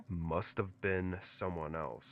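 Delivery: cold, flat monotone voice. A narrator's reading. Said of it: "much colder, monotone voice".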